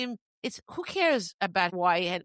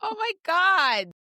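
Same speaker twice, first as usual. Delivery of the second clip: surprised exclamation; 10 semitones higher, 6 dB louder, slower than usual. Real, not acted. Reacting to movie title.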